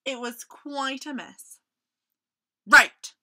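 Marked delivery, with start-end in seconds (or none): 2.7-3.1 loud, decisive "right"; firm. Acted. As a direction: take charge. Mouse taking charge.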